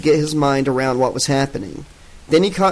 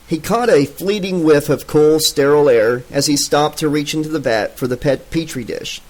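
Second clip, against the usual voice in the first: plain reading voice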